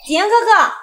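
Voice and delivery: high, cutesy coaxing call; sugary. Acted. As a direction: sweeten it like a flirt. Sugary address to the man.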